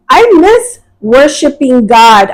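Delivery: high, slow, drawn-out plea; retold child's yell. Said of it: "she yelled and she's like".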